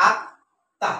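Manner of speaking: আত্মা in two beats with a gap between; আত্মা broken after its first syllable; split into syllables. Shown as a demonstration. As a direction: dictate আত্মা one syllable at a time. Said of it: "এইভাবে লিখতে হয়".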